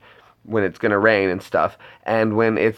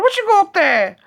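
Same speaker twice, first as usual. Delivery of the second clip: very high falsetto squawk; Python mother. Retold or imitated. Acting out the Brian manger scene, high falsetto. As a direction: play the suspicious mum at the door.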